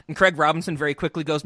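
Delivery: ordinary delivery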